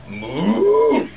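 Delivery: long, loud moo sliding upward; cow moo. Retold or imitated. Storyteller as the cow going off the roof, loud mooing. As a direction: imitate a cow's drawn-out moo. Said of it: "the cow goes".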